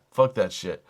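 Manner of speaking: usual speaking voice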